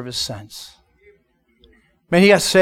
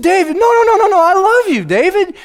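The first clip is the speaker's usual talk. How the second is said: much higher, louder pleading no no no; urgent reassurance; 13 semitones higher, 5 dB louder than usual. Retold or imitated. Pastor begging David to believe he is loved, high and urgent. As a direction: insist he's loved, all out.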